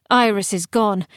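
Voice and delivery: usual speaking voice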